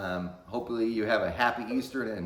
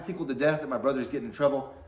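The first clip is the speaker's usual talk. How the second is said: ordinary talk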